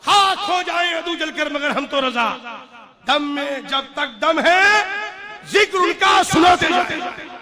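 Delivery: slow, loud, lifted couplet recital; poem recitation. Acted. Preacher reciting a couplet to the crowd, slow and loud. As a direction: declaim each line slowly.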